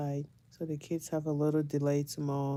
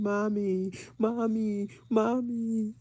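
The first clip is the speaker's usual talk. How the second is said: higher, drawn-out whine for mom; imitated screaming child; 5 semitones higher, slower than usual.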